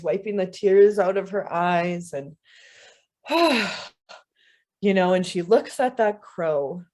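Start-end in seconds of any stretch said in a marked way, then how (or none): 3.3-3.9 high, relieved exhale after laughing; spent sigh. A narrator's reading. Woman wiping her tears, spent sigh.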